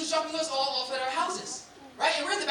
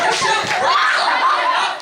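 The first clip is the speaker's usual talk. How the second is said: much higher, loud, laughing; hyped shout; 9 dB louder, much slower than usual. Acted. Comic recalling getting hyped for worship, shouting high. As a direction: yell it like church just started.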